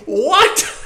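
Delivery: high, loud laughing disbelief; shocked what. Retold or imitated. Reacting to the husband's story, a laughing shocked what. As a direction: burst into stunned laughter at the story's twist. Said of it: "And I was like".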